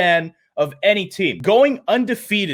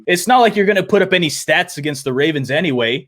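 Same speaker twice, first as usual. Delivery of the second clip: usual speaking voice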